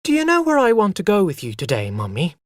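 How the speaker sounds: put-on a small boy, fast and eager; eager child. A narrator's reading. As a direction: rush it out like an excited little boy. Child voice in story.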